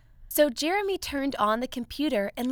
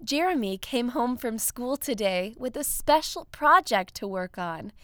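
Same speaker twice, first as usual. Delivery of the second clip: ordinary delivery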